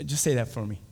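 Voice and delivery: ordinary delivery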